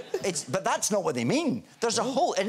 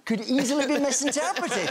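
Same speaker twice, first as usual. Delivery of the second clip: ordinary talk, nothing marked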